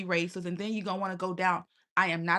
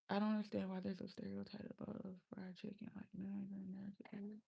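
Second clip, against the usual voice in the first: hushed, breathy muttering under the breath; whispered aside; 15 dB quieter, faster than usual. Real, not acted. Streamer whispering an aside to her viewers. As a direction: drop to a secret whisper. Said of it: "whispered aside".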